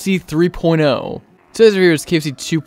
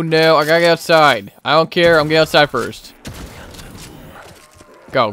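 ordinary talk

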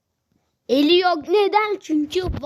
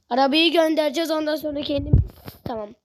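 ordinary talk, nothing marked

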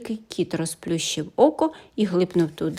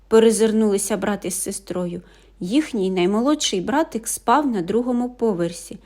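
ordinary delivery

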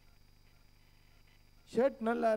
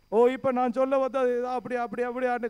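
loud, raised preaching voice, pressing the questions; forceful preaching; 3 semitones higher, 7 dB louder than usual. Acted. Pastor challenging the brothers in church, loud forceful preaching. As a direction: hammer each question at the congregation.

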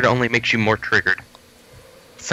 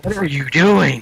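put-on voice; 6 semitones higher, 3 dB louder than usual. Mocking exchange in funny voices.

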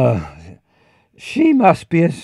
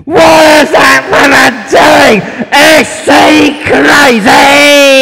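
shouting in disbelief; incredulous shout; 9 semitones higher, 15 dB louder than usual. Real, not acted. Shocked at a woman's antics.

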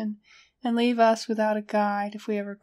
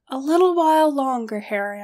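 louder, higher opening plea; pleading; 4 semitones higher, 6 dB louder than usual. A narrator's reading. Narrator begs Harry for more time, pleading. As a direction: plead with a partner to hold on. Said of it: "I pleaded".

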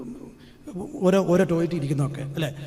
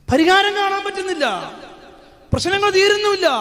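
high, loud preaching cry; preaching shout; 13 semitones higher, 5 dB louder than usual. Real, not acted. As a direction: cry it out to the whole congregation. Preacher cries out to congregation. (ഉച്ചത്തിലുള്ള പ്രസംഗ നിലവിളി)

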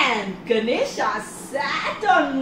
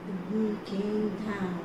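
plain reading voice

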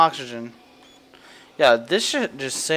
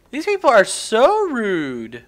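1 drawn-out mock complaint; 5 semitones higher, 5 dB louder, slower than usual. Real, not acted. Repeated slower with stress.

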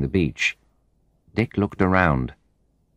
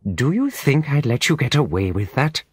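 furious; 8 semitones higher than usual. A narrator's reading. Molly's explosion of anger.

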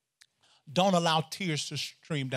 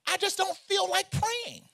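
high and loud, rising fervor to the crowd; preaching at full force; 13 semitones higher, 5 dB louder than usual. Acted. Pastor fires up the congregation, preaching loud and high. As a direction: preach it hard, voice climbing.

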